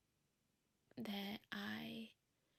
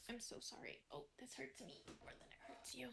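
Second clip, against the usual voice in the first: hushed, tender pet talk; cooing to cat; 7 dB quieter than usual. Real, not acted. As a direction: coo softly to the cat as it interrupts. Whispering sweetly to her cat.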